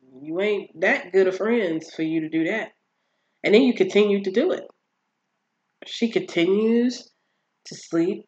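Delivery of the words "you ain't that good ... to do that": ordinary talk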